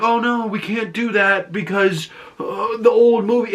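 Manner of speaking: high, put-on fretful voice; mocking excuse. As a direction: mimic the filmmakers making a feeble excuse.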